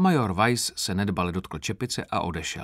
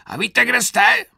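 high, loud interrogating shout; yelled; 14 semitones higher, 10 dB louder than usual. A narrator's reading. Sergeant grilling an insolent recruit, high-pitched yell.

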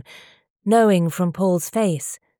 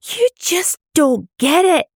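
much higher, louder, slow shout; 11 semitones higher, 5 dB louder than usual. A narrator's reading. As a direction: yell it at him, fed up. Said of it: "she'd shouted".

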